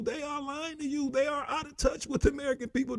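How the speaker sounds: usual speaking voice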